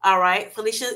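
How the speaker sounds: ordinary delivery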